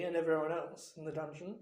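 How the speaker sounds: ordinary delivery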